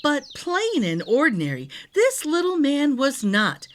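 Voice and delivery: ordinary delivery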